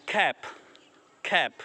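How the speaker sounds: ordinary talk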